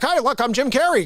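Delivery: normal talking voice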